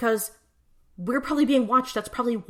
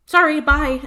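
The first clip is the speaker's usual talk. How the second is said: loud dismissive bye; 7 semitones higher, 10 dB louder than usual. Retold or imitated. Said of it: "And he's like".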